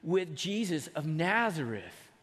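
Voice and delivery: slow, drawn-out accusing words; a servant girl slowly pinning Peter down; slow accusation. Acted. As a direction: lay the charge out slowly. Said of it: "looks at him closely and says".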